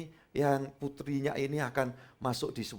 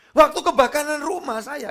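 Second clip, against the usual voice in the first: normal talking voice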